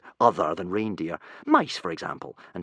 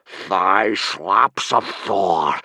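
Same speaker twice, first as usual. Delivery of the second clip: slow, louder growl; 5 dB louder, much slower than usual. Acted. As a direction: grind out the curse. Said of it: "growled Gobber".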